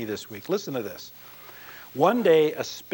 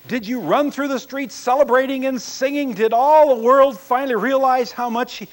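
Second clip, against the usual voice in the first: eager pleading; 10 semitones higher, 9 dB louder than usual. Acted. Alien eagerly pleading for details.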